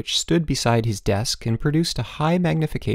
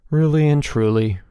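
high start, firm falling confirmation; assuring reply; 4 semitones higher, 4 dB louder than usual. A narrator's reading. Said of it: "replied Bruno".

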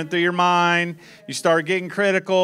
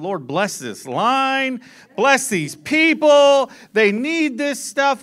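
slow, louder, higher prayer; retold prayer; 5 semitones higher, 4 dB louder, slower than usual. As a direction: pray it out slowly, pleading. Praying in the checkout line.

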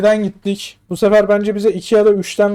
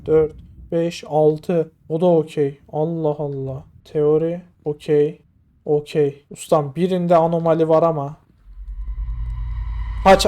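normal talking voice

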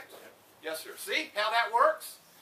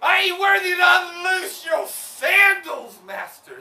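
humble cry; 6 semitones higher, 9 dB louder, slower than usual. Acted. Humble shout to master.

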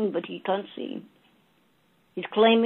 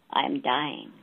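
much lower, soft and weak buffalo voice; dying buffalo; 7 semitones lower, 4 dB quieter, slower than usual. A narrator's reading. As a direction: let the dying beast breathe it out low. Buffalo voiced in told story.

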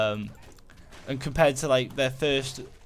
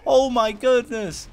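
alarmed; 10 semitones higher, 5 dB louder than usual. Real, not acted. Zombies rushing him in game.

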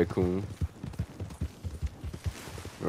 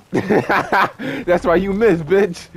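slow, laughing taunt; mocking laugh; 6 semitones higher, 4 dB louder, much slower than usual. Real, not acted. Gamer laughs at the enemy who missed him. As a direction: laugh through the insult.

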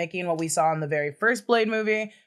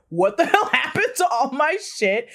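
higher, laughing indignant outburst; mock outrage; 7 semitones higher than usual. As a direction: play an outraged demon, half laughing. Voices the demon finding his things gone.

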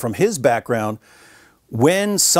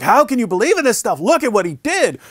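high, forceful challenge; pitched-up demanding attack, leaning in; blasting; 10 semitones higher than usual. Retold or imitated. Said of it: "but I was like".

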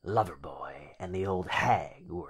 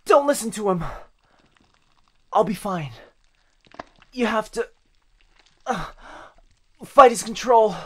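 put-on higher, much louder strained cry of a trapped boy; desperate boyfriend; 13 semitones higher, 15 dB louder than usual. Trapped boyfriend urging the girl to resist, frantic shout. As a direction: shout a warning through his control. Boyfriend breaks through, pleading.

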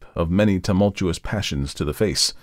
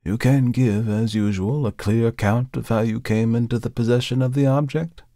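raised pitch, mildly ironic; businesslike, with a slight ironic edge; note of irony; 5 semitones higher than usual. A narrator's reading. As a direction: ask it with a touch of irony. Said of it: "still with a note of irony".

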